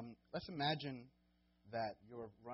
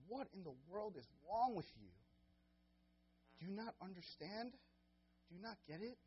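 higher, exasperated questions; Paul scolding the Galatians, higher and frustrated; 8 semitones higher than usual. Retold or imitated. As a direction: voice Paul's disappointment with the Galatians. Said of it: "sense Paul's frustration".

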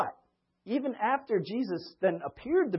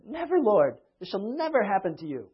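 high, loud, insistent refusal; Peter's loud protest; 4 semitones higher, 8 dB louder than usual. Retold or imitated. Peter protesting to Jesus, loud and high.

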